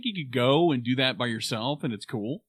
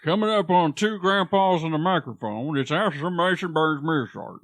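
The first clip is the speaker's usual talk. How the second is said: announcer voice; 3 dB louder than usual. Acted. Mock show intro announcement.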